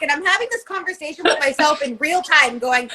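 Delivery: ordinary delivery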